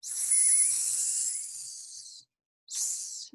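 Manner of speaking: soft long hiss; quiet snaky sss; snake hiss. Shown as a demonstration. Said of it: "what sound do snakes make".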